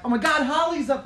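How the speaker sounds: high squealing with laughter; excited girl voice. Retold or imitated. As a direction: squeal like a thrilled fan. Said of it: "the girl's like".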